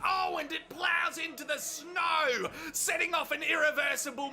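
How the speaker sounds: building commentator shout; excited crash call. Acted. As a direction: call the wipeout like live sport.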